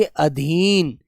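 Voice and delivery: अधीन said softly with a short first अ, as अ-धीन; अधीन spoken gently and carefully; slow correct demo. Shown as a demonstration. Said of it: "हमें ए का प्रयोग करना चाहिए".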